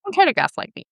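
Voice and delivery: high, quick, mildly upset retort. Retold or imitated. Getting upset on the phone with him, quick high retort. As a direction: push back quickly with a touch of upset. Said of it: "like, really upset".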